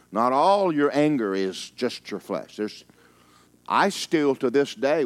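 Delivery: loud, high preaching push; full-force sermon; fiery preaching. Acted. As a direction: drive the point home to the pews.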